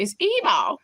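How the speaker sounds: dramatic mimicked "evil" with a falling end; Tracy Barbie's evil. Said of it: "That's how she say it".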